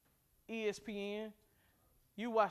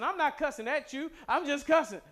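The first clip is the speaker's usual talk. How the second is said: mock defensive retort; 7 semitones higher, 8 dB louder than usual. Mock argument about cussing.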